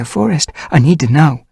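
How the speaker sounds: high, loud urgent plea; urgent young she-cat. Acted. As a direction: press him for an answer. Dovepaw pleading with Tigerheart.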